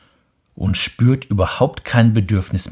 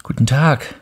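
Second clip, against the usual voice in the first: put-on higher, bright child's greeting; a child saying hello, raised pitch; little prince greeting; 6 semitones higher, 5 dB louder than usual. The little prince greets the switchman, higher child voice. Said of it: "sagte der kleine Prinz".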